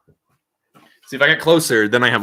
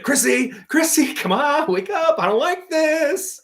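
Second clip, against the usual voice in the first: very high, whiny pleading; high mock plea; 6 dB louder than usual. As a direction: squeak it out like a scared kid. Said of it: "And he's like".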